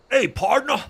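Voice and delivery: loud, high mock-Texan call; attempted American accent. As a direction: holler like a hammed-up American cowboy. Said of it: "I can't do American accent".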